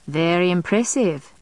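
mildly bored, unimpressed. A narrator's reading. Friend dismisses the vampire's snarl, mildly bored. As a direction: say it with understated boredom. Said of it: "Hamish said in a bored tone".

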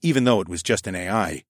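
usual speaking voice

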